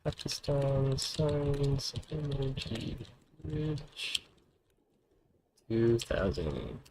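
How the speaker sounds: slow, hushed reading of a news ticker; slow, soft mock news read; headline read. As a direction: read the game headline out like a newsreader. Reads news headline aloud slowly.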